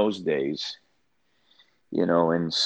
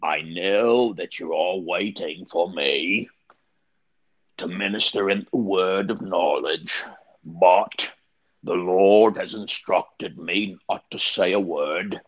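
slow, measured Cockney preacher imitation; Eddie's Cockney accent. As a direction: announce it to the congregation in imitated Cockney, drawing out the words. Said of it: "he had a Cockney accent".

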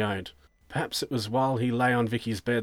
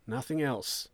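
softer, falling answer; subdued confirmation; 2 semitones higher, 4 dB quieter than usual. Acted. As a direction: confirm it plainly to the interrogator.

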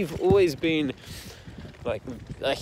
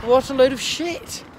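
scornful dismissal; 10 semitones higher than usual. Retold or imitated. Scorns the mock decree.

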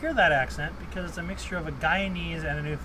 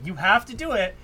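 ordinary delivery

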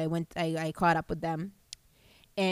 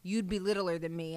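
normal talking voice

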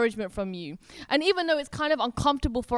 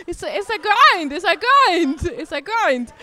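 high, loud, emotional outburst; worked-up cry; 5 semitones higher, 5 dB louder than usual. Real, not acted. Preacher to congregation, overcome mid-exhortation, crying out high. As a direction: cry it out as the feeling overflows.

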